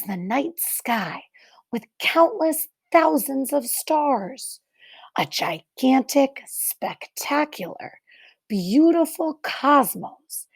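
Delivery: put-on reading to children. Teacher reading a picture book to the class, hushed and wondering.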